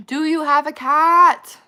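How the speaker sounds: excited loud question. Real, not acted.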